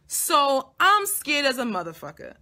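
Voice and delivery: ordinary delivery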